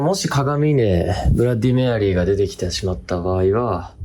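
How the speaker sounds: ordinary talk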